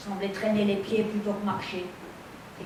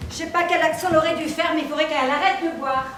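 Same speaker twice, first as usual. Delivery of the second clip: loud, high-pitched exasperation; exasperated outburst; 6 semitones higher, 6 dB louder than usual. Acted. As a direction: blurt it out, fed up, voice raised and pushed up. Exasperated quarrel over drunken rehearsal. (exaspérée, voix forte et haut perchée)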